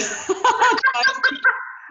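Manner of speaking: bright burst of real laughter; short amused laugh. Real, not acted. Host laughs at acronym joke.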